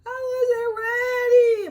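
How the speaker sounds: high, slow, crying mock shout; mock panicked wail. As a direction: wail it like someone shoved before they were set. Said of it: "you gonna be like".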